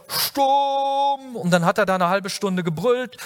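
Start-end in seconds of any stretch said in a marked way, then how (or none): 0.1-1.4 high, drawn-out mock yell of one word; exaggerated mock roar at a storm; mock bellow. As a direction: bellow it long and high, hammed-up. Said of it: "Er hat da nicht gebrüllt".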